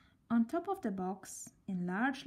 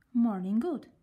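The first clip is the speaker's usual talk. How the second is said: bright louder greeting; cheerful; 8 dB louder than usual. A narrator's reading. Mister Topsy-Turvy greeting them cheerfully. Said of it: "he said cheerfully".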